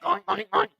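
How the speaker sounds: high squealing oinks; pig oinks. Retold or imitated. Mocking the pig with high oinks. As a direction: oink like a pig.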